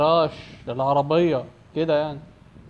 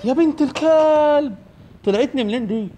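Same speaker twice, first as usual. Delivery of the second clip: startled shout; 11 semitones higher, 7 dB louder than usual. Real, not acted. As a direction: yell it in shock. Granny jumps out suddenly.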